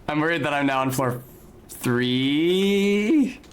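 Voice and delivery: ordinary talk, nothing marked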